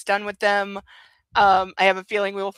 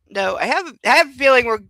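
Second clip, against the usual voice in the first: usual speaking voice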